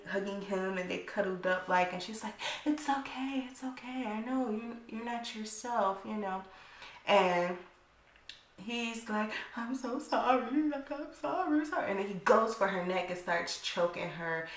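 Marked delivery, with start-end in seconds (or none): 2.6-6.4 higher, softer soothing voice; soothing comfort. Retold or imitated. Claudia comforts him, acted quote. 9.6-11.9 higher, tearful pleading sorry; mock sobbing sorry. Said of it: "he's up here crying".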